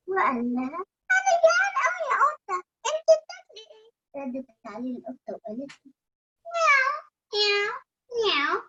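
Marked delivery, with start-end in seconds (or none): 1.2-3.9 put-on high, fast, whiny child voice; little bunny voice. Bunny asks cat. 6.5-8.6 kitten voice. Acted. Cat meows.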